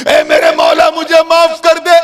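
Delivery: high, loud cry to God for mercy; hammed-up wailing supplication; crying, begging plea. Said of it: "वो रो रो कर, गिड़गिड़ा". (गिड़गिड़ाती, ऊँची पुकार)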